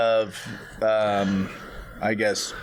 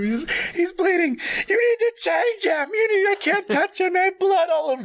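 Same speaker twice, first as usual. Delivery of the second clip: hysterical mom. Acted. Mother losing it over fake blood, high and wailing. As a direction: wail it like a frantic mother. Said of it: "She's crying".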